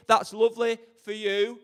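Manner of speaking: ordinary delivery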